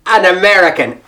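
put-on effortful, deliberate American accent; emphatic, carefully American-accented repeat; concentrated American accent. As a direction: correct yourself into firm American vowels. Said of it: "had to concentrate to get that good American accent back".

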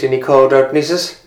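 ordinary delivery

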